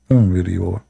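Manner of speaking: normal talking voice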